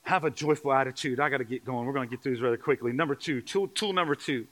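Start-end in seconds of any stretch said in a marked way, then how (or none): none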